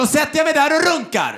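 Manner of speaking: high-pitched, indignant mock reply; defiant retort. Guest answers receptionist about computer, defiant retort. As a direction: pitch it up, brazen and fed up.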